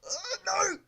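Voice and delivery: high loud despairing cry; anguished shout. Real, not acted. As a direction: cry out as you fall.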